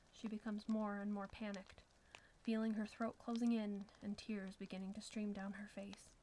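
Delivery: ordinary delivery, nothing marked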